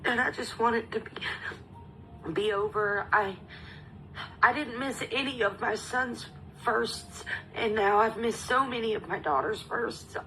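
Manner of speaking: tearful, breaking voice, high and strained; crying. Real, not acted. Mother who missed her daughter's firsts, crying. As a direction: push the words out through tears. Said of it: "sorry, I'm trying not to cry".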